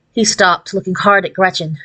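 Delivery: usual speaking voice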